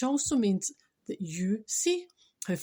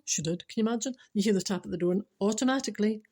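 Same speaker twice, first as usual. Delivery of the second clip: ordinary talk, nothing marked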